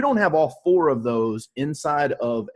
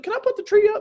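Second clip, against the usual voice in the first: high, squeaky girlfriend impression; 3 dB louder, faster than usual. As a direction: pitch way up as his girlfriend. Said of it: "She's like".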